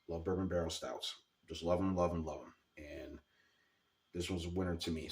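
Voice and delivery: ordinary delivery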